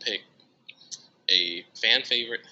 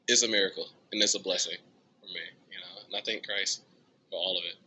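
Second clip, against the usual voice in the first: usual speaking voice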